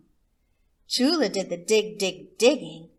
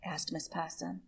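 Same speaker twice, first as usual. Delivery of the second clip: usual speaking voice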